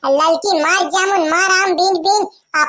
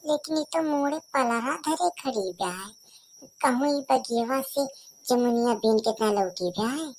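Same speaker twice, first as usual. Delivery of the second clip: much softer, lower muttered aside; quiet aside; 4 semitones lower, 10 dB quieter than usual. Acted. Woman remarking to herself on Lalki standing with a basket, softly under her breath. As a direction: say it under your breath so she won't hear.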